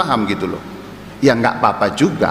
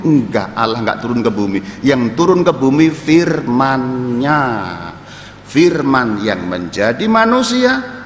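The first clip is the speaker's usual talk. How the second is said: ordinary talk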